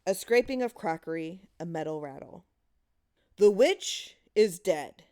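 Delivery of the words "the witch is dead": slow, loud solemn intoning; intoned. A narrator's reading. Husband intoning to the children in the dark cellar, slow and loud. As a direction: chant it like a ritual. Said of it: "intoned the husband".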